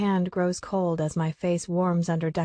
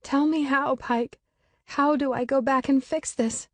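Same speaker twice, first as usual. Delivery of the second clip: desperate tearful plea; 7 semitones higher than usual. A narrator's reading.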